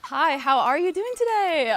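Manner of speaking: high, bright and loud, cheerful service greeting; customer service voice. Shown as a demonstration. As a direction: greet a customer on the phone, sweet and eager. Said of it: "Can I hear your customer service voice?".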